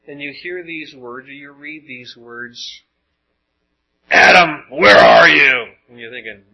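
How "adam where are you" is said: thunderous, furious shout; angry God. Acted. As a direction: roar it slowly, like God mad at Adam. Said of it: "was that really how he said it".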